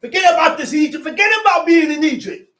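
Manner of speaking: urgent pulpit exhortation; fast preaching shout. Acted. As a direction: fire it at the congregation.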